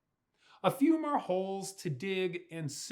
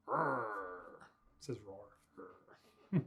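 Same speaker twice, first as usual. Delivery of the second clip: growl; 11 semitones lower, 9 dB quieter than usual. Acted. Bulldozer growl.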